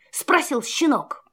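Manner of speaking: ordinary delivery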